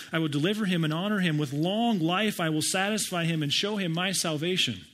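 full-voiced sermon reading; scripture proclamation. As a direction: declaim it like a promise from God. Reading God's promise aloud.

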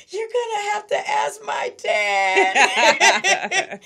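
high, loud, slow sobbing wail through laughter; crying wail. Retold or imitated. Girl telling boyfriend he must ask dad, sobbing loudly. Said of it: "I just busted out crying".

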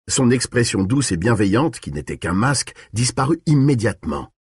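ordinary delivery

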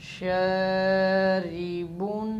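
शरीबुन drawn out with a ringing -un ending; tanween recitation. Shown as a demonstration. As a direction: stretch शरीबुन slowly in chant. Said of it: "बा दो पेश".

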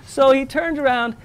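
ordinary talk

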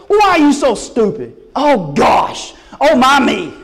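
high, loud mock scolding of a hopeless believer; hammed-up sigh at a foolish child; mock exasperated God. Pretend God scolding the congregation, a mock exasperated rant. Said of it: "God does not look at you and say".

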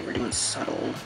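whisper. Real, not acted. Whispered repeat.

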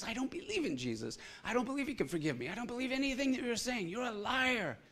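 high, fast defiant denial; defiant woman. Retold or imitated. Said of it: "she goes".

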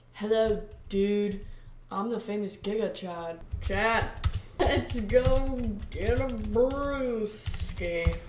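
loud, slow dudebro drawl; loud bro voice. Pretend. Playing Giga Chad, inviting the viewer for beers in a loud bro voice. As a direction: boom it like a gym bro.

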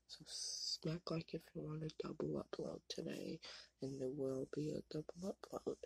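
quiet early-morning whisper. Real, not acted. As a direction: whisper so the house stays asleep. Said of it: "I'm whispering".

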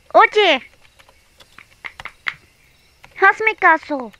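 high, loud call for attention; demanding call. Acted. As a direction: call out to be noticed.

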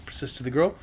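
usual speaking voice

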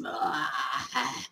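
low rasping laugh that cracks; a cracked laugh through a sore throat; croaky laugh. Real, not acted. Sam laughing at her own croaky voice, rasping laugh.

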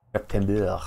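lightly put-on French accent. Streamer joking about French former workmates, French accent. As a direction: say it with a hint of French. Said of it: "I can do it with a French accent".

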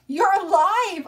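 high, loud, drawn-out cry; joyful shout. A narrator's reading. Son to his father who drove up, relieved shouting. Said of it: "I shouted".

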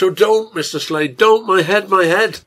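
loud, high cry of distress; yelled plea. A narrator's reading. Wife re-enacting sick child's feverish cry, yelled plea. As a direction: yell out, begging him to stop. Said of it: "Once she yelled out".

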